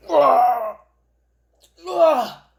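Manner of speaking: loud satisfied yell after a bite; energized roar. Real, not acted. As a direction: let out a rush-of-energy shout. Energy rush after eating.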